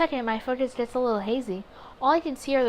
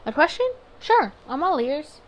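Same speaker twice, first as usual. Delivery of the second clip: eager; 5 semitones higher, 6 dB louder than usual. Acted. Reading own eager reply.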